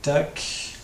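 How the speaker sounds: ordinary talk, nothing marked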